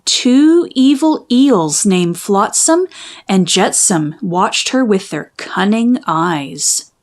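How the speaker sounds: ordinary delivery, nothing marked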